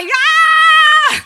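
high, loud triumphant shout; excited yeah. Retold or imitated. Preacher showing the boastful reply she holds back, shouting. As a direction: whoop it out with full confidence. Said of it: "Everything in me is wanting to say".

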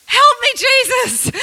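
high, slow pleading cry; desperate prayer. Retold or imitated. Preacher mock-praying before church, high slow plea. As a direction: plead for help, half laughing at herself.

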